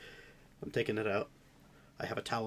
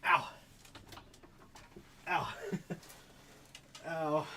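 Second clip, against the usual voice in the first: hammed-up pain groans; playing up the pain; 7 semitones higher, 6 dB quieter, much slower than usual. Real, not acted. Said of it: "I'm only playing this up slightly".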